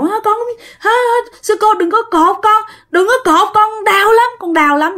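put-on very high little-kid voice, pleading; wailing child. Possessed woman begging the nun to untie her, high child wail. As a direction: wail like a small child in pain. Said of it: "Mà cứ gào".

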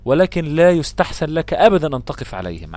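ordinary delivery

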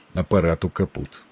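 ordinary delivery

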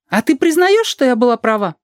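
high, challenging character voice; creaky-voiced woman. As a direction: demand an admission in a high pitch. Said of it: "Она говорит своим скрипучим голосом".

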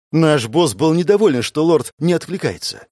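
ordinary delivery

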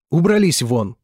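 loud shouted order. Acted. Woman ordering both brothers out, shouting. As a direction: throw them out with a yell.

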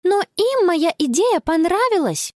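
put-on high, insistent girl's protest; protesting objection. Girl argues back at her mother, high protest. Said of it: "возразила Конни".

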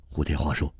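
ordinary delivery

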